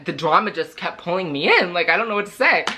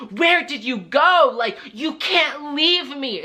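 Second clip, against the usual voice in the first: higher, slow mock scolding mother; scolding mom; 8 semitones higher, slower than usual. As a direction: drag out the scolding like a clingy mom. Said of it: "she's like".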